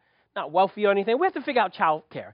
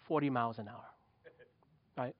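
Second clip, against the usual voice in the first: ordinary talk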